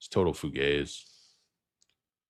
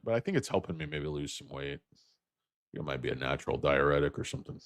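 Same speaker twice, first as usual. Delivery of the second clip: usual speaking voice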